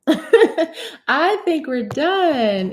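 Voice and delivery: slow, drawn out through a laugh. Real, not acted. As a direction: giggle through "I think we're done" as you stretch it out. Repeats it laughing.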